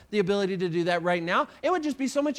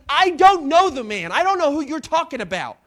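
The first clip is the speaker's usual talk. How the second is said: louder, heated denial while swearing; angry denial; 5 semitones higher, 8 dB louder than usual. Acted. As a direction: snap the denial out, loud. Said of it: "he starts to swear".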